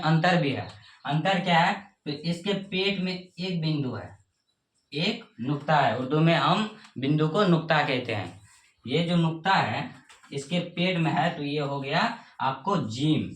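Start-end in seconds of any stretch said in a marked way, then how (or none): none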